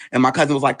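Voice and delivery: normal talking voice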